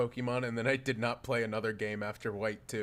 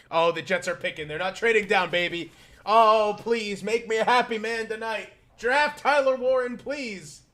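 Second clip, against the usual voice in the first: high, loud, begging plea; excited pleading; 8 semitones higher, 6 dB louder than usual. Real, not acted. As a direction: beg the team to pick him. Begging for the draft pick.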